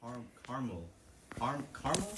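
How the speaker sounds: caramel squeezed down to "carmul"; two-syllable caramel. Shown as a demonstration. Testing how caramel is said, two-syllable "carmul".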